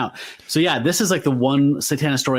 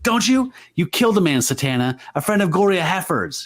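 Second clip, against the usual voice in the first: mock accusation; 7 semitones higher than usual. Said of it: "He's pissed".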